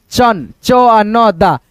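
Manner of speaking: चंदा slowly broken into च, आ, न, दा; slow teacher's breakdown of चंदा; spelling out sounds. Shown as a demonstration. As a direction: give each sound of चंदा separately. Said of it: "ऐसे करके".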